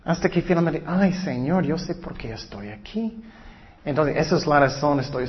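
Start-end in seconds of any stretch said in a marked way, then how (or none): none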